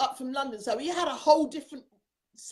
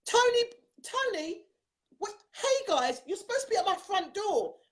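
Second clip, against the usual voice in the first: surprised hail; 6 semitones higher than usual. Real, not acted. Host hailing the guest expected at her front door, surprised. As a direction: call out in higher-pitched surprise.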